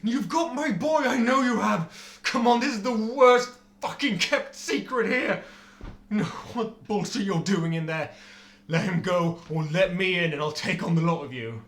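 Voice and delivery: loud, raging father at a gate; angry shouting. Acted. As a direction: shout him down, seething. Father yells at the castle gate.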